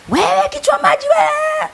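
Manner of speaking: high, drawn-out mock shout; hammed-up angry driver's holler; road-rage yell. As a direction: hurl the insult at a driver cutting in. Acted road rage at matatu driver.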